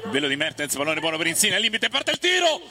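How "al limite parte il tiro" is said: raised, louder shot call; excited call. Real, not acted. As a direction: lift the voice on the shot. Shot from the edge.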